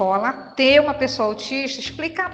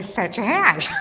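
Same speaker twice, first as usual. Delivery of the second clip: laughing; 3 semitones lower than usual. Real, not acted. Student's answer amuses teller.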